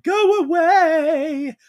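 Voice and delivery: high, loud, drawn-out yell; hammed-up shriek at someone outside; acted scream. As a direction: stretch it into a long, high imitation scream. Acting out frightened woman's yell.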